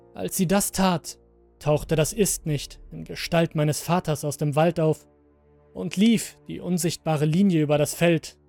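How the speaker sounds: plain reading voice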